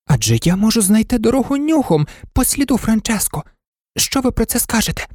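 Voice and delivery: high, hesitant little dog voice; timid. A narrator's reading. Toy dog timidly offering his idea to the others, high voice. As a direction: venture it shyly. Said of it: "боязко промовив курдуплик".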